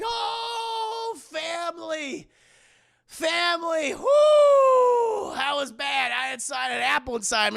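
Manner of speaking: high, drawn-out rapturous cries; hammed-up relief with long whoops; mock revived boy. Revived Caleb greets his family, hammed-up elation.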